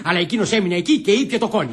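ordinary talk

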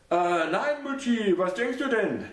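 son's voice, mildly defensive. A narrator's reading. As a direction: wave off her suspicion.

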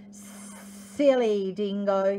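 very slow with stretched s sounds; drawn-out sibilant sneer; hissing lizard. Acted. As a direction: hiss it like an old lizard. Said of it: "he hissed".

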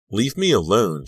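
high, loud outburst; angry yell. A narrator's reading. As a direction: yell to drive him away. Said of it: "she yelled".

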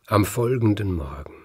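ordinary delivery, nothing marked

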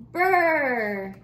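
a loud shivering brr. Acted. Reading about winter cold.

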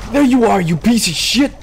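real angry gamer yelling at an enemy. Real, not acted. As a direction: yell it so he hears you. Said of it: "He heard me call his name".

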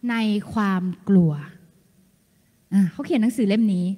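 ordinary talk

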